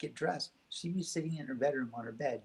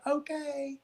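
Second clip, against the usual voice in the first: put-on child answering. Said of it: "And I would say".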